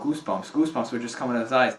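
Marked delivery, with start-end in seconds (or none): none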